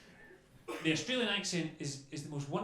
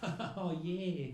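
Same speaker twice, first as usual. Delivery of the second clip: relaxed Australian; 2 semitones higher than usual. Retold or imitated. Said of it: "they sound so relaxed all the time".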